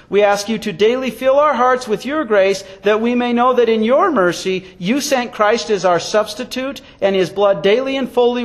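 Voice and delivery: steady, projected congregational prayer; closing prayer. Real, not acted. Pastor concluding the sermon, formal prayer. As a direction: lead the congregation firmly in prayer.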